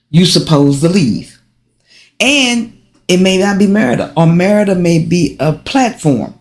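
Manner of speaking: ordinary talk, nothing marked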